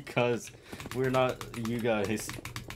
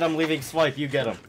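louder, higher, insistent callout; insistent repeat; 3 semitones higher, 5 dB louder than usual. Real, not acted. Player repeating the swipe call to a teammate, insistent. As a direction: push it out louder to be heard. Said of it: "I said".

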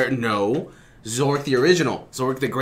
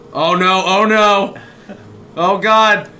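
alarmed; 7 semitones higher, 7 dB louder, slower than usual. Real, not acted. Alarm at game event.

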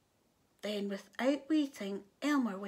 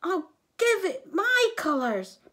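higher, louder storybook hero voice; determined Elmer; 6 semitones higher, 6 dB louder than usual. A narrator's reading. Elmer declares his plan to help the rainbow, determined. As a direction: announce the plan with emphasis.

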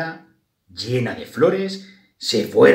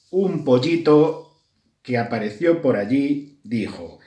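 usual speaking voice

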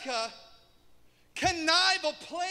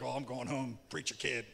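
low, soft gossiping aside; onlookers gossiping; 11 dB quieter than usual. Retold or imitated. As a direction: mutter it like a nosy neighbour. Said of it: "Everybody be like".

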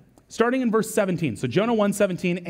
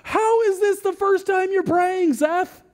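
much higher, slow incredulous question; mock disbelief; 13 semitones higher, slower than usual. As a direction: read Seth's story in playful shock. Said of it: "all of us would be like".

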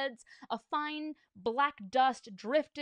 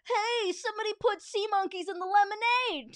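high, loud outraged holler; high-pitched crowd yell; shouting; 10 semitones higher, 6 dB louder than usual. A narrator's reading. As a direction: yell it like a complaint from the stands. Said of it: "people shouting everything from".

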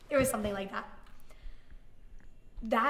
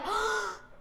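long, high acted gasp; dramatic gasp; 11 semitones higher than usual. Grabbing the ringing phone, dramatic gasp. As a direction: gasp in huge anticipation. Seeing who is calling.

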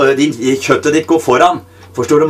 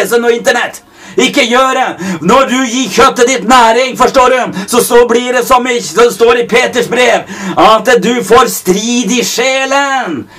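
full-force preaching; 7 semitones higher than usual. Acted. Preacher urging the congregation to starve the flesh, fervent. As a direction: preach it hard, pushing each line.

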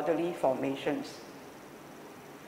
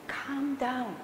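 soft, higher soothing demonstration; hushed calming; 5 semitones higher, 6 dB quieter than usual. Shown as a demonstration. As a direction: gently hush the breath down. Demonstrates quietening the breath.

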